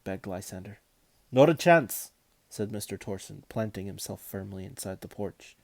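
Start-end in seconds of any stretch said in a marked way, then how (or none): 1.3-2.1 stern and loud; firm loud refusal. Acted. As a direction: bar the door with your voice.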